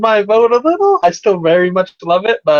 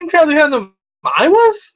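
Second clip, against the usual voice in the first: disbelieving protest; 9 semitones higher than usual. Real, not acted. Defending Hunnam in disbelief.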